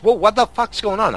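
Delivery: high, loud, alarmed retelling; mock-alarmed outburst; startled driver. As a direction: imitate a driver caught off guard, pitch jumping up.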